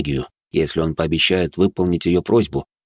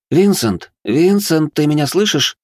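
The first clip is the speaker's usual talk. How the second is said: high, loud anxious calling; 9 semitones higher, 4 dB louder, slower than usual. A narrator's reading. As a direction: call his name to wake him. Marika calls him back awake.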